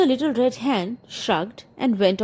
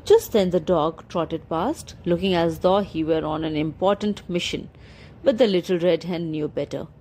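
usual speaking voice

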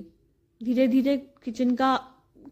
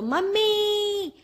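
long drawn-out higher call; calling mom; 7 semitones higher, much slower than usual. Retold or imitated. Daughter home at mother's, drawn-out call.

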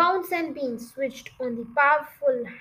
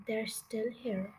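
slow, much softer, hushed; hushed report; 4 semitones lower, 11 dB quieter than usual. A narrator's reading. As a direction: keep it low so they won't hear. Mr Fox reports quietly to family.

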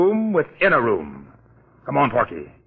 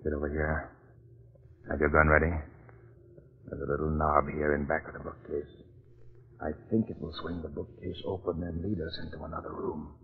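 low, much softer, careful voice; hushed; 9 semitones lower, 12 dB quieter than usual. Acted. Jeff quietly tells Porky about the hidden knob, hushed low voice. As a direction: speak low so no one hears. Secret room search, hushed.

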